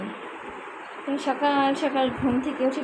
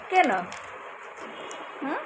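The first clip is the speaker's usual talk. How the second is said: put-on cooing baby talk; 3 semitones higher, 4 dB quieter than usual. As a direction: coo like talking to a baby. Mother coaxes sulking toddler.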